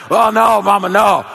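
put-on loud, high kids' protest; mock complaining. Kids upstairs objecting to Mama's offer, loud mock complaint. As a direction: plead with Mama in hammed-up dismay. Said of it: "We were complaining".

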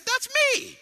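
preacher imitating his grandson's delighted yell; excited toddler shout. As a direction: shout it like a thrilled three-year-old. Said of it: "He said".

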